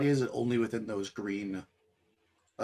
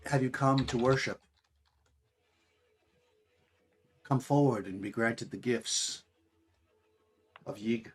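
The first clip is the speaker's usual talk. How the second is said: eerie voice calling from the darkness, slowing down; a hidden woman luring worshippers; soft female voice; 4 dB louder, slower than usual. Acted. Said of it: "a pretty soft, uh, female voice from the darkness".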